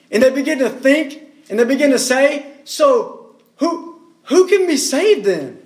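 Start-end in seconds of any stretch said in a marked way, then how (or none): 2.6-5.6 bewildered, halting question with a repeated "who"; lightly acted confusion; confused disciples. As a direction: ask it as puzzled disciples, stumbling at the start. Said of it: "Do you see the confusion in their mind".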